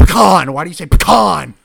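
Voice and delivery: loud, exasperated outburst on puh-KAHN; yelled challenge; exasperated shout. Real, not acted. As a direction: shout it back in disbelief. Shouting in disbelief at the pronunciation.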